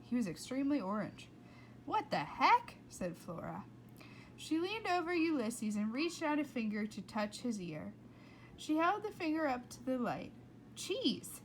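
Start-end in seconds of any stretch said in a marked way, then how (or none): 1.9-2.7 high, loud startled question; surprised. Acted. Flora finding her squirrel glowing orange, surprised. 10.7-11.4 high sudden exclaim; surprised realization. A narrator's reading. Narrator discovering the cheese dust, surprised.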